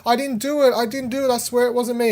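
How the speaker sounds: put-on high pleading kid voice; vehement denial. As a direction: mimic a scared child denying. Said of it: "he's like".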